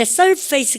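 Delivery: surface said as "sur-FACE", like the word face; mispronounced surface. Shown as a demonstration. Teacher showing students the ignorant mistake, surface mispronounced as sur-face. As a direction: say surface wrongly, ending in "fayss". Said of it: "그러면 정말 무식하고".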